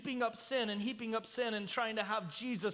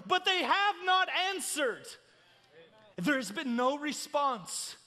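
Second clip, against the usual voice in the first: raised, driving sermon delivery; impassioned preaching; 6 semitones higher, 5 dB louder than usual. Real, not acted. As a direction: preach it with mounting urgency. Preaches at full force.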